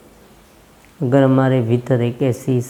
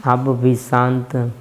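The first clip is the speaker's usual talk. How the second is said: guided meditation. Acted. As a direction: ease the listeners into stillness, unhurried. Leads a breathing meditation.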